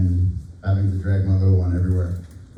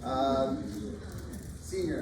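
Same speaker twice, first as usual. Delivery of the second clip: projected, higher announcing voice; teacher voice; 7 semitones higher, 11 dB quieter than usual. Real, not acted. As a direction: call the names out across the room. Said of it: "Can I use my teacher voice?".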